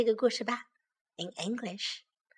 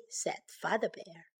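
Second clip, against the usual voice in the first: ordinary delivery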